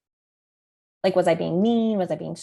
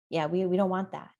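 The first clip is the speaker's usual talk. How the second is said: ordinary talk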